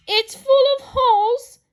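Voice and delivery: loud, very high wail of dismay; horrified outburst, sustained high and loud; exclaiming. A narrator's reading. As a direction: cry it out, appalled. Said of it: "exclaimed Missus Rogers".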